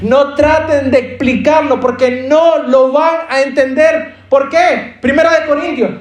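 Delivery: high, driving preacher voice, pushing each line; preaching at full force. Acted. Predica con fuerza creciente. (predicando con fuerza, voz alta y urgente)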